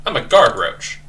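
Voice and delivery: lightly voiced happy roach guard; a touch of cheerful bug pride; guard roach announces itself, mildly cheery. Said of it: "cheery, happy voice".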